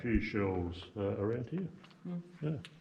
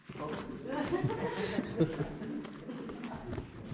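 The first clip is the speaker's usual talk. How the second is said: breathy laugh; 5 dB quieter than usual. Real, not acted. Laughs at his own joke.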